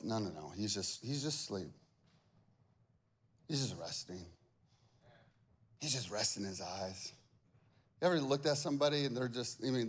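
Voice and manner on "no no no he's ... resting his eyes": low, soft, lightly voiced Jesus; Jesus' voice. Jesus reassuring disciples in a low soft voice. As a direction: calmly insist he's only resting. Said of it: "And Jesus goes".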